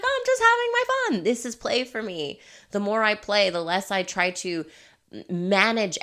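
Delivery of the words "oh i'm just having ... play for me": high, louder sing-song breeziness; carefree. Acted. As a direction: toss it off like it's nothing. Her breezy reply to team.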